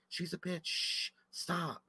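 very soft, secretive shushing; urgent hushing. Retold or imitated. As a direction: hush someone under your breath. Retold hushing of wife.

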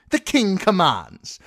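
put-on high, loud royal decree from a spoilt boy; boy king commands. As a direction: proclaim it like a child playing king.

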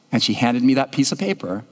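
normal talking voice

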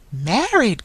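loud, high exclamation of shock; startled cry. A narrator's reading. Blind girl hears of the wedding, startled cry. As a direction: cry out, taken aback. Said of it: "cried the blind girl, starting from him".

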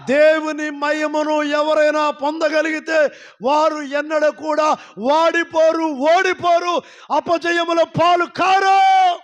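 slow, high and loud, then loud praise calls; shouted preaching climax. Acted. Pastor rousing the congregation to praise, shouted climax. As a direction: lift the room with a rousing shout.

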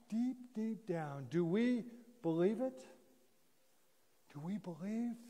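ordinary talk

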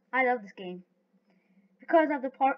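usual speaking voice

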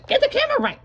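yelled order. Acted. Telling the cameraman to fix the camera, shouting. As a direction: bark it at the cameraman.

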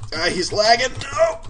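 high-pitched frantic cry; frustrated yelp. Real, not acted. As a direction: rise into a high squeal of "no". Frantic fight, opponent lagging.

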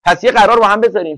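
usual speaking voice

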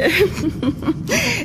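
high brief giggle to cover a slip. Acted. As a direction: laugh it off quickly. Landlady covers odd remark. (hohes, verlegenes Kichern)

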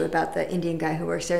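ordinary talk, nothing marked

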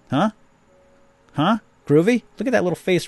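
high teasing. Real, not acted. Teasing his own catchphrase.

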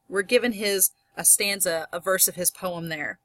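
usual speaking voice

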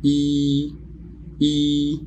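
drawn-out "إي" as a long ee; long i vowel demo; long ee sound. Shown as a demonstration. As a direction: stretch the "إي" vowel. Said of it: "بيبقى اسمه إي".

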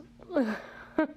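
a little airy laugh under the breath; breathy whispered laugh. Real, not acted. Laughs at the huge box.

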